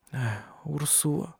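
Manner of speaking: hushed, slow sigh of a name; soft whisper. A narrator's reading. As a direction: breathe her name weakly. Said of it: "шептала Сесилия".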